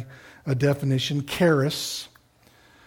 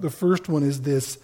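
normal talking voice

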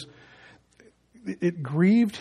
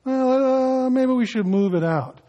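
mock-casual shrug, pitch lifted higher; casual, offhand; 6 semitones higher than usual. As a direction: play it light and unbothered, a lazy little shrug. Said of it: "come in casually and go".

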